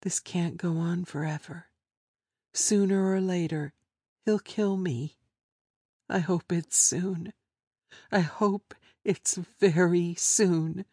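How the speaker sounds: woman thinking in despair; hopeless inner voice. Acted.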